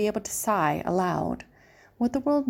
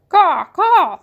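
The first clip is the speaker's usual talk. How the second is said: high, loud crow caws; 10 semitones higher, 11 dB louder than usual. A narrator's reading. The Pellegrina crow answering Edward, loud high caws. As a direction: caw like a mocking crow. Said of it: "said the Pellegrina crow".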